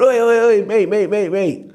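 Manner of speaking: a loud, high call across the shop; calling after someone who is leaving; imitating a bookie shouting "oi, mate".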